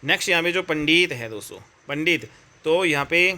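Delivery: ordinary talk, nothing marked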